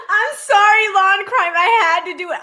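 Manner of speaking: high, loud words through laughter; helpless laughter. Real, not acted. Streamer apologizing to Law and Crime, laughing hard. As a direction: apologize while cracking up. Cannot stop laughing at clip.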